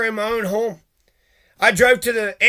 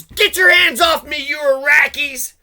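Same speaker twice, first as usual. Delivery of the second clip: retold shout; 4 semitones higher than usual. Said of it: "he goes".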